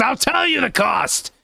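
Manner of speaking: hammed-up doom prophecy; dramatic proclamation. Fortune teller revealing the cost of saving the gopher, booming. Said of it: "But at what cost?".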